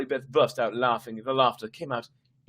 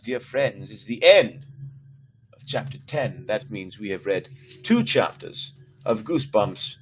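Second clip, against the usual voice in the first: ordinary delivery, nothing marked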